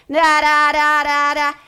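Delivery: loud, high droning read-aloud. Shown as a demonstration. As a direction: blare it out like mechanical reading. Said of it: "this is her reading voice".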